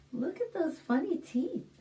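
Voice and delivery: ordinary delivery, nothing marked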